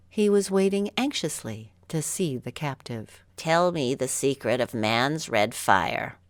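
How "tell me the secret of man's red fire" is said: lower, demanding monkey king voice; a greedy king demanding a secret. Acted. Monkey King demands the fire secret from captive Mowgli, lower voice. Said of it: "the Monkey King said".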